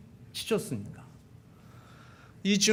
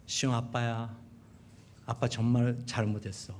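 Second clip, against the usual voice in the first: sheepish plea; 6 semitones lower, 9 dB quieter than usual. Retold or imitated. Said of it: "장남한테 전화했습니다".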